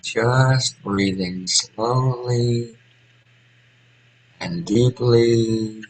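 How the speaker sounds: very slow, spacious meditation guidance; guided meditation. Real, not acted. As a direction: guide them into slow breathing, stretching words between long pauses.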